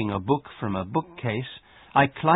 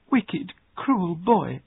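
higher, slow indignant outburst; indignant accusation; 7 semitones higher, slower than usual. A narrator's reading. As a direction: accuse the bully, drawing out each word high. Accusing her bully cousin.

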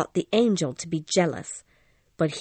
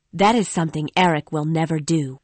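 ordinary delivery, nothing marked

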